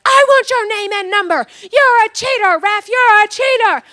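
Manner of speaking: high, loud angry accusation; mock furious sideline shouting; imitated screaming. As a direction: yell it at the referee like an irate dad. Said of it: "they are screaming at each other".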